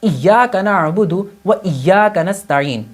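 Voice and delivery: slow Quranic recitation of the verse, iyyaka with doubled ya; correct recitation. Shown as a demonstration. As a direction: recite it slowly with the shadda held. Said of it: "میں ایک example دیتا ہوں". (ایاک نعبد کو تشدید کے ساتھ آہستہ پڑھنا)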